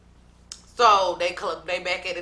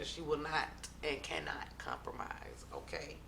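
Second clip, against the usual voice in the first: whisper; 6 semitones lower, 16 dB quieter than usual. Real, not acted. Whispered aside about O G.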